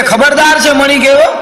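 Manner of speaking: high-pitched acted outburst; angry warning. Wife warns husband about the mobile, acted angry outburst. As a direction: jump up high and warn them off.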